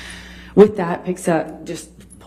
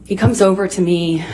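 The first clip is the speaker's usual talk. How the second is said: ordinary delivery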